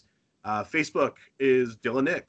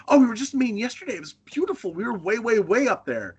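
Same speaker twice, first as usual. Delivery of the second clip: gushing tourist; 9 semitones higher than usual. Retold or imitated. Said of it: "They're like".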